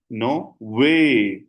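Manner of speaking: long, swelling "वे" that rises and falls; emphasis on वे. Shown as a demonstration. Teacher demonstrating emphasis, a long swooping "वे". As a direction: lean hard on "वे" and stretch it. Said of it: "थोड़ा सा emphasise इस पे करना है".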